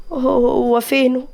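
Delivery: wounded, hesitant and drawn out; heartbroken. A narrator's reading. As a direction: ask haltingly with a broken heart. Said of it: "مليكة بكسرة".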